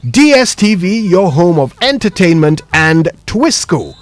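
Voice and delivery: slow, stretched radio announcer tags; sponsor read. Acted. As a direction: boom out the sponsor lines. Host reads sponsor tags.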